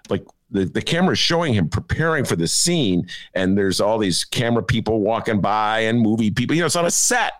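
normal talking voice

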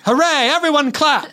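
very high excited shout; rally everyone to applaud; gleeful cheer. Acted. Misplaced cheer at bad news.

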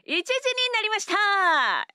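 high, slow, announcer-bright opener; radio show opener. Shown as a demonstration. Asked how she opens her show, high slow radio announcer call. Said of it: "how do you start your radio show".

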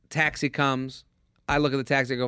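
ordinary delivery

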